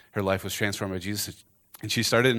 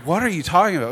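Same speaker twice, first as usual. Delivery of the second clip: higher and louder puzzled question; bewildered, pitched up; confused disbelief; 9 semitones higher, 8 dB louder, faster than usual. Retold or imitated. Garbage man confused by gift.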